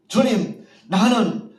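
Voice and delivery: loud, high declaration; bold request. Acted. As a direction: proclaim your wish to the Lord with fervor. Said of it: "물으시는 것을 기억하시고". (성도들에게 본보기로 외치는 힘찬 간구)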